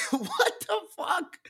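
high, slow words through laughter; laughing disbelief. Real, not acted. Cracking up at his own Karen impression, high laughing disbelief. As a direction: squeak it out while cracking up.

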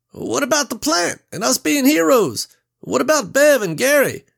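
high, loud, frantic cry; panicked yelling. A narrator's reading. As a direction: yell in panic while running. Reggie yells while fleeing.

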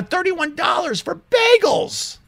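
very high-pitched exclamation; outraged. Real, not acted. Ranting about being expected to tip, outraged. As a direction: pitch it way up in disbelief.

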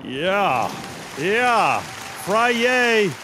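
loud, high celebratory shout; hype shout. Acted. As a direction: hype it up like a party.

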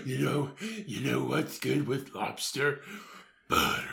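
slow, brooding fat superhero voice; fat Batman. Acted. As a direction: savour the food in a hero's low voice. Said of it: "You're fat Batman".

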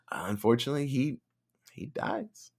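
a soft unvoiced whisper. Real, not acted. Telling listeners Roger dies, hushed whisper. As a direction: breathe it out as bad news.

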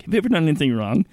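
earnest evangelist. Retold or imitated. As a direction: rattle it off like an eager evangelist. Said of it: "Mike Seaver ... walks up to you and is like".

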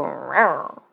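low playful growl; a sultry animal growl; sexy badger voice. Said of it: "sexy badger voice right there".

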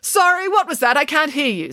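high, loud "sorry?"; loud pretend deafness. A narrator's reading. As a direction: call out as if you can't hear. Pretending not to hear.

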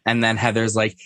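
ordinary talk, nothing marked

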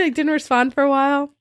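normal talking voice

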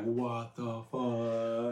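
slow, drawn-out and low; imitated slow-motion bewilderment; stunned disbelief. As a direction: stretch every word, softly dumbfounded. Imitating Ashley's stunned reaction.

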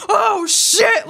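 a hammed-up retold jolt of alarm; shocked curse. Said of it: "like I've never heard before".

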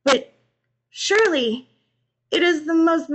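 high, loud, halting distress; tearful words broken by pauses; sobbing. A narrator's reading. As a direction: struggle to get each word out. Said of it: "Christina sobbed".

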